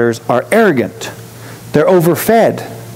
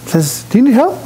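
bright, much higher friendly greeting; friendly call out; 10 semitones higher than usual. Retold or imitated. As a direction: call out kindly to a stranger, pitched way up. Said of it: "I was like".

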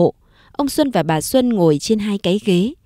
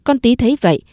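plain reading voice

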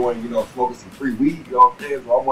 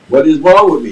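louder, higher panicked question; confused alarm; 5 semitones higher, 9 dB louder than usual. Retold or imitated. High teen wondering what's happening to him, loud confused question. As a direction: blurt it out, suddenly scared. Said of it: "So I'm like".

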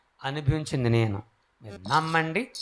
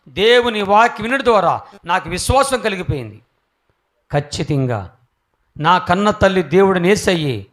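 louder, higher preached line; fervent preaching; 4 semitones higher, 9 dB louder than usual. Acted. Preacher declaring his faith to the crowd, raised voice. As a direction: declare it like a sermon.

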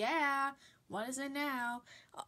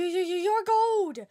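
louder, rising surprise; shocked exclaim; 4 semitones higher, 9 dB louder than usual. Acted. Reindeer seeing Glisten's gold antlers, shocked. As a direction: gasp it out in shock.

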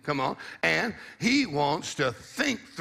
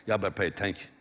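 West Virginia drawl; 7 semitones lower, 5 dB quieter, faster than usual. Shown as a demonstration. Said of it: "That's what they say in West Virginia".

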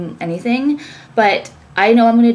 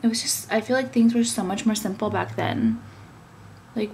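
ordinary delivery

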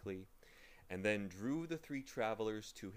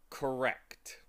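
tolerant smile; 4 semitones higher, 6 dB louder than usual. Acted. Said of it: "with a tolerant smile".

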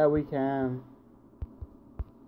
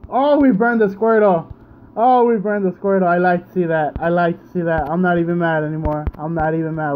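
real delight, louder; excited cheer; 7 dB louder than usual. Real, not acted. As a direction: gloat over the burn. Celebrating burn on Squirtle.

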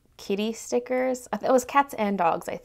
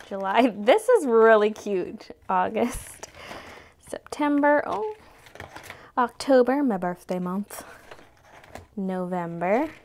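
ordinary talk

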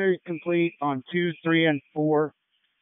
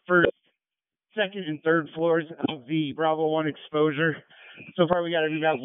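ordinary delivery

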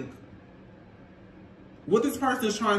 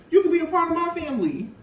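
higher, faster, pushed repeat; emphatic mock repeat; 7 semitones higher, faster than usual. The same offer repeated to his partner, higher and faster. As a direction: hammer his offer home again. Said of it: "This man is like".

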